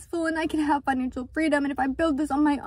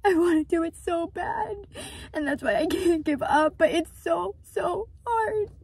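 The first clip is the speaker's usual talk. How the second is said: tearful, voice cracking higher; crying; 3 semitones higher than usual. Real, not acted. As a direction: voice breaking through real tears. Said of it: "trying to just cry on the internet".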